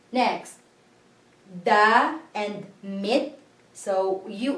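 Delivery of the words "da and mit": da and mit said slowly and apart, i as ee; slow syllabication. Shown as a demonstration. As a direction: stretch da and mit into separate beats. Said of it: "we always syllabicate it".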